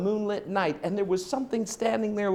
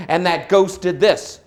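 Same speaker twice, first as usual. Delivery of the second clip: ordinary delivery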